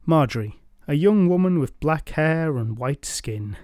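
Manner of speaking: usual speaking voice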